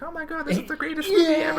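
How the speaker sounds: very high, fast, thrilled squeal; mock kid excitement. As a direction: gush like an overexcited kid, hammed up. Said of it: "I was like".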